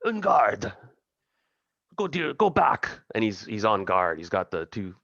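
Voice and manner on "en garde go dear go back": put-on French accent cry, raised pitch; french chef. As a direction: chef shouting en garde again. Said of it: "he's like".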